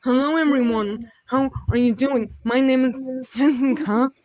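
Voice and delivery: talking only through the nose; imitating a nasal neighbor. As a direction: mimic a woman who speaks through her nose. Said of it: "She was talking like".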